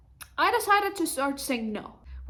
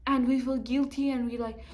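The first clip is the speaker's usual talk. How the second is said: usual speaking voice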